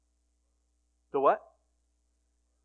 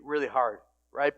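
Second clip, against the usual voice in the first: normal talking voice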